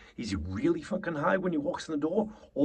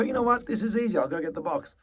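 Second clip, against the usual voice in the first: flippant and casual; 5 semitones higher, faster than usual. Shown as a demonstration. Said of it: "'Cause if it was anything less than that".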